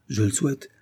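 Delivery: usual speaking voice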